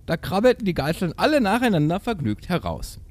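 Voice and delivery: high, animated fairy-tale narration; theatrical storytelling. A narrator's reading. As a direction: read it with exaggerated storybook delight. Reads the tale aloud. (überschwängliche Märchenerzählung)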